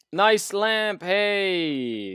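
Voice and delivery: loud, high, drawn-out holler; calling out. Real, not acted. Streamer greeting the chat, loud high drawn-out call. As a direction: holler it high and stretch the end.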